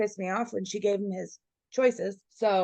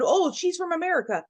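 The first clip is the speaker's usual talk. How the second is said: high-pitched imitated retort; mock correction; 9 semitones higher than usual. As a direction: mimic someone piping up to correct you. Mimicking an imagined objection.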